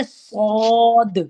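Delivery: صاد drawn out slowly, hissing and thick, with a light bounce on the final دال; heavy whistled saad. Shown as a demonstration. Teacher reading صاد first for the student to notice. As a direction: hiss and fatten صاد, then bounce the دال. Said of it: "اس کو ہم تھوڑا سا ہلا دیتے ہیں".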